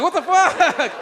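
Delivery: high, loud incredulous yell; mock outrage. Comic reliving being mistaken for homeless, loud disbelief. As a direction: hit it with stunned disbelief.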